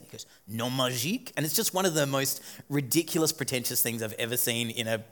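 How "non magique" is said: imitated French pronunciation, "magique" ending in a soft zh-eek; French accent. Speaker mocking a film villain's pretentious word, French accent. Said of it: "pronounces it with a French accent".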